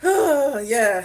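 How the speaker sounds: a sob-laden yeah, real tears; real crying. Real, not acted. Streamer overwhelmed by viewer messages, crying yeah. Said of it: "I feel so emotional".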